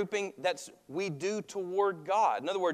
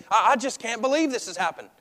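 higher, louder flustered sputter; exasperated disbelief; 7 semitones higher, 7 dB louder than usual. Retold or imitated. Angry person telling everyone it happened, exasperated. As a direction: stammer it out, upset and fed up. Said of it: "gotten angry and all you knew to do was just keep saying the same thing".

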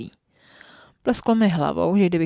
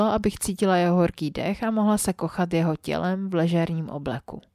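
ordinary delivery, nothing marked

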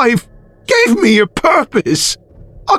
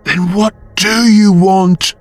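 fearful; 10 semitones higher, slower than usual. A narrator's reading. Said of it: "fearful of the answer".

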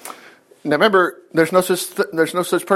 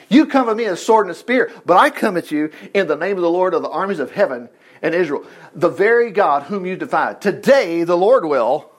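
ordinary talk

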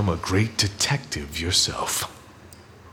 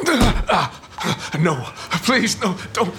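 terrified pleading; 11 semitones higher than usual. Acted. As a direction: beg for your life. Boss begging for his life.

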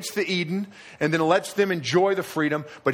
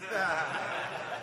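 a short quiet chuckle; soft amused laugh; 4 semitones higher, 10 dB quieter than usual. Real, not acted. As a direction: laugh softly at the story. Laugh after the kid story.

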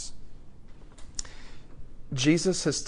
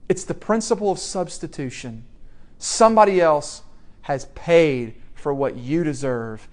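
usual speaking voice

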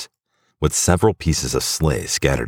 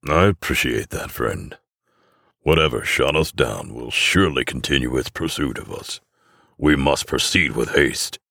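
lightly voiced Santa, measured and in command; a hint of a steady Santa voice; Santa giving orders. Santa instructing his elves after the crash, lightly voiced commander.

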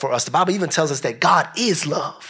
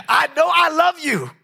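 excited declaration; 11 semitones higher than usual. Real, not acted. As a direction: shout it out with joy. Laughing, voice jumps high.

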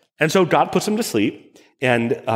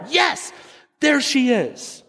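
excited crush; 10 semitones higher, slower than usual. Shown as a demonstration. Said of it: "that's the great part".

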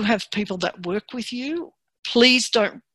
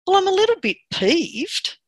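much higher, light mock understatement of being peeved; understated mild complaint; 11 semitones higher than usual. Shrugging off anger with a light high peeved. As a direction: play it down, barely bothered by it. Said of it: "And the other person said".

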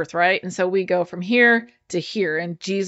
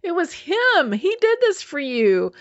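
higher-pitched imitated wife's amazement; excited retelling; 8 semitones higher, slower than usual. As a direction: mimic her awed excitement, pitch raised. Acting out the excited wife.

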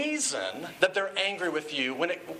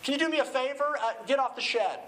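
higher, casual call off to the side; a lightly voiced suburban dad calling out; sketch character; 7 semitones higher than usual. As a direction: call to a kid while keeping it light. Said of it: "he says".

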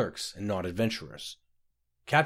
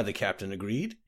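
usual speaking voice

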